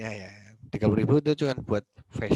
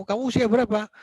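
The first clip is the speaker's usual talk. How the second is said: higher-pitched, mildly cross demand; mock angry questioning; 9 semitones higher, 4 dB louder than usual. Lecturer plays Amanda grilling the rider, mock angry. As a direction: play at interrogating someone crossly. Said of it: "marah sama kamu".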